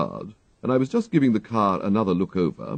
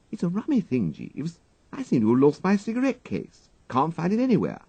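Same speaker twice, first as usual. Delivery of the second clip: put-on higher, breezy young toff; young gentleman Wooster; 10 semitones higher than usual. A narrator's reading. Wooster asks his valet for a cigarette, breezy toff voice. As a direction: cadge a smoke lightly, upper-class chap.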